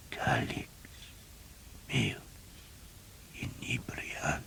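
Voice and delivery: Calix meus inebrians breathed in a faint, slow whisper; the last faint Latin whisper of a dying man; scarce above a whisper. A narrator's reading. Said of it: "in a voice scarce above a whisper".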